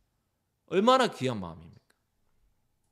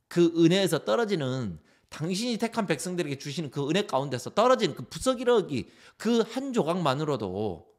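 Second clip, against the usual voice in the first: raised, impassioned pleading; fervent plea; 6 semitones higher, 4 dB louder than usual. Acted. Gentile woman begging the Lord for crumbs of grace, fervently. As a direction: plead earnestly for the smallest scrap.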